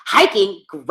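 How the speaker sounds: lightly imitated girly disgust; mock squeamish woman. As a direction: say it like a grossed-out woman. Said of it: "I'm not a woman that's like".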